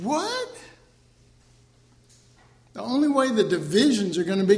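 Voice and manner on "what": very high rising question; mock disbelief. Preacher reacts to conference boast, mock disbelief. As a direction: squeak it in exaggerated disbelief.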